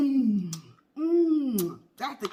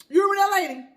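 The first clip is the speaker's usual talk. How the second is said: usual speaking voice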